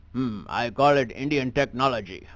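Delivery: put-on comic thick Indian accent; stand-up bit done in a heavy Indian accent. Comedian on stage doing his act in an Indian accent. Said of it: "I gotta drop the accent".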